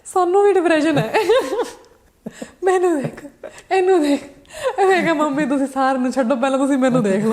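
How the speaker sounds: loud, high-pitched protest through laughter; mock dramatic mother. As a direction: imitate an indignant Punjabi mum, laughing. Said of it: "first reaction".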